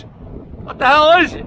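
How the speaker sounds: high, loud, slow disbelief; baffled statue guy. Retold or imitated. Statue figure asking his friend, slow high loud disbelief. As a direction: drag out the shock at the thing. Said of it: "He goes".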